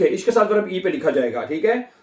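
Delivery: ordinary talk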